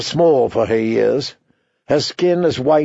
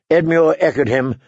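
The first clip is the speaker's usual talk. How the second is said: ordinary delivery, nothing marked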